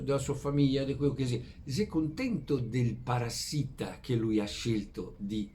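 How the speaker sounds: ordinary talk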